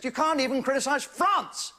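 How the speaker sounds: high exclaimed France; mock-indignant high cry; mock outrage. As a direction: spit it out in mock disgust.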